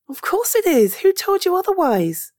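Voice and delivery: high-pitched, incredulous insistence; indignant reassurance. Acted. Friend defending someone's right to cry, indignant. Indignant that crying was forbidden.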